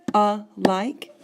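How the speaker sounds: "A like" split into two punched beats; word split into syllables. Shown as a demonstration. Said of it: "two syllables in that one word".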